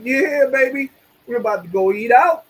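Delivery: excited. Retold or imitated. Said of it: "I'm like".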